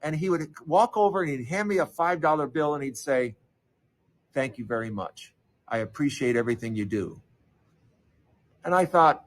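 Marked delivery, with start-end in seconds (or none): 4.3-7.2 put-on grateful old man. Old customer thanking the paperboy at the door, soft and low. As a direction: lower and softer, a gently voiced old man.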